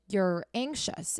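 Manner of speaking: ordinary delivery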